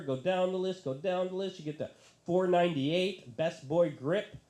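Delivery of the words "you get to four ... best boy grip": usual speaking voice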